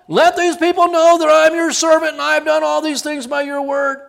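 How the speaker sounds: high, loud, grand pleading to the Lord; loud showy prayer. Retold or imitated. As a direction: proclaim it loudly, showing off before the crowd. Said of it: "Remember that?".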